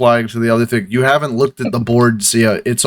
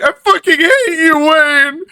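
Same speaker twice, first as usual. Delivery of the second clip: much higher, louder hammed-up sob; mock crying; 3 dB louder than usual. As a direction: blubber it while storming off. Clerk storms off crying.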